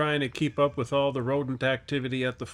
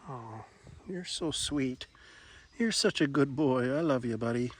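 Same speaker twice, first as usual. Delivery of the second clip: tender; 5 dB quieter than usual. Real, not acted. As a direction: melt over your pet. Cuddling the cat tenderly.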